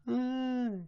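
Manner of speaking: high put-on crying wail; mock wail. Mimicking a crying toddler's wail. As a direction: imitate a toddler's drawn-out wail.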